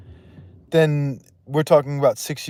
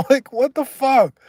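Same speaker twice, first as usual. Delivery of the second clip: incredulous; 13 semitones higher, 5 dB louder than usual. Real, not acted. Disbelief at machete story.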